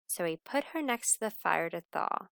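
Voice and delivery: plain reading voice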